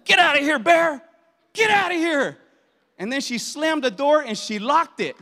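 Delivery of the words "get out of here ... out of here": loud, high-pitched shout to drive it off; retold yell. Wife yelling at a bear at the campsite, loud high yell. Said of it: "I yelled at him".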